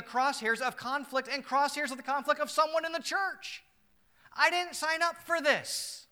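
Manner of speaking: high, loud, pleading outburst; voicing Gaius, distressed. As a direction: cry out as a wronged believer in anguish. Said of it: "Gaius could say".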